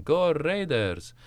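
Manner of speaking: a reluctant dad forcing out a slow cheer; dad's slow cheer. Retold or imitated. The dad pushed into rooting for the Raiders, slow, high and unconvinced. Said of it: "my dad's going".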